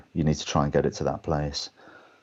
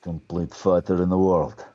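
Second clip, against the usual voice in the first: slow, boastful fighter character voice; deliberate put-on tough-guy declaration; Boyka. Actor giving fans his fighter's catchphrase, proud and menacing. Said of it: "Can we hear a little boiker?".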